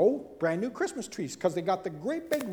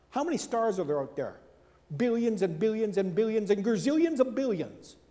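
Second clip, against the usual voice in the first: usual speaking voice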